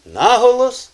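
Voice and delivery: "голос" run into "на" as one word, НА punched; loud "на голос" with the stress moved onto НА; stress on first syllable. Shown as a demonstration. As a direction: hit НА hard in "на голос", like НАголос. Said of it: "А якщо поміняти на голос".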